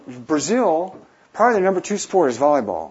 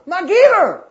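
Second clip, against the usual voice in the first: high, loud shout across the street; calling out; 10 dB louder, slower than usual. Retold or imitated. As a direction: yell his name from across the street. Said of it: "and they'd go".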